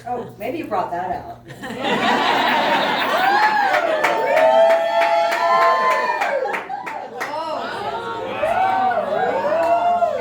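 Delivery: high, very loud squealing laugh; delighted shriek. Real, not acted. She squeals as she is shown the necklace.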